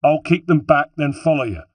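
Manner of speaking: high, loud and urgent; insistent. A narrator's reading. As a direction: urge him on over the din of a fight. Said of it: "insisted Holden".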